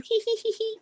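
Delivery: high, soft giggling hee hee; a high mock giggle; imitated teen giggle. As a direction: mimic teenage girls giggling. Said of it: "how teenagers would do".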